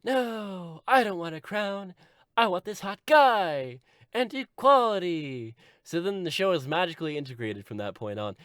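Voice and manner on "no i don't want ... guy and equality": high, stretched-out declaration; hammed-up heroine making demands; Tracy. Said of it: "But then she's like".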